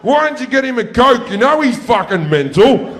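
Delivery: high, loud mock-exasperated mum. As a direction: mum blaming her son, hammed up. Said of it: "my mum turns to me and goes".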